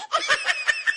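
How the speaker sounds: mocking laugh at the daughter-in-law. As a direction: cackle at her.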